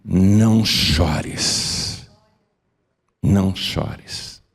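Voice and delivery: very slow, gentle "Não chores" repeated; Jesus consoling the widow, tender and slow; tender comfort. Acted. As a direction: soothe a grieving mother, slowly. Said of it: "e disse-lhe".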